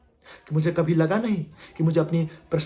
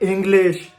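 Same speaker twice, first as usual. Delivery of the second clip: loud, insistent cut-in; interrupting interviewer; 6 dB louder, slower than usual. Acted. Interviewer cutting off the candidate mid-word, loudly insistent.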